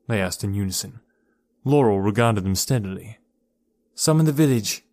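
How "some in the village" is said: raised, urgent call; a touch louder, urgent cry; cried out. Acted. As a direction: call it out, alarmed. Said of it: "she cried".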